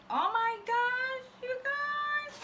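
high, slow, delighted squeal; unboxing fan squealing to viewers; squealing excitement. Real, not acted. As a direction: let the joy squeak out. Excited about Polyjuice recipe.

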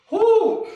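high excited woo cheer. Real, not acted. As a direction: whoop it out high. Workout cheer.

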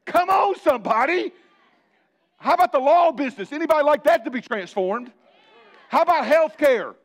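high, loud shout to the crowd; calling out. Real, not acted. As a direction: rouse the congregation with a shout. Preacher calling out to congregation.

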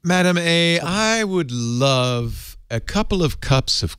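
slow, drawn-out flowery oration; hammed-up courtly plea to a machine; mock poetic request.

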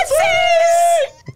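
squealed high and loud; excited shout. Acted. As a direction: shout it out for the camera. Announcing engagement for TikTok.

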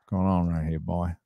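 low mock southern accent. Co-host joining the southern bit, low mock accent. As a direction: put on a low southern good-old-boy accent. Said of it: "have to speak with a southern accent".

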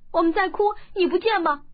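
put-on loud, high child's voice, tearful and sharp; a boy answering the girl between sobs; crying retort. A narrator's reading. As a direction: snap back through tears. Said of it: "哭道".

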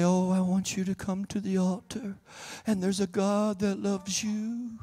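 mock-meek preacher, soft and slow; wimpy preacher. Preacher mocking soft altar calls, wimpy soft voice. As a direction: softly plead like a timid little cat. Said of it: "preach like a wimpy person, like a little cat".

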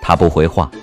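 ordinary delivery, nothing marked

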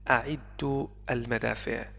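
ordinary talk, nothing marked

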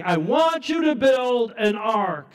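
high, loud, drawn-out command; God's booming command. Acted. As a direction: decree it slowly from on high. God commanding Noah, voiced loud.

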